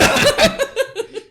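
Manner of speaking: squealing laugh; high laugh. Real, not acted. Burst of laughter.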